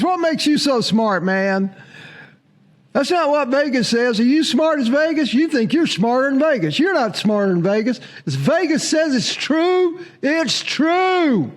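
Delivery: high, pushy mock-argumentative fan voice; indignant Georgia commenter. Georgia fan defending Vegas odds to the host, mock-indignant high voice. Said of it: "second kind of comment from Georgia people is".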